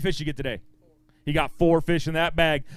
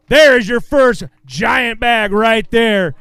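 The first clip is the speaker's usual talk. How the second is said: louder, slowed-down hype call; excited hype; 4 semitones higher, 9 dB louder, much slower than usual. Real, not acted. Emcee hyping the first giant bag to the crowd, louder excited call. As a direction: keep the crowd roaring.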